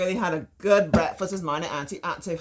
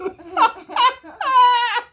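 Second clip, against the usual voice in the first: laughing; 9 dB louder than usual. Real, not acted.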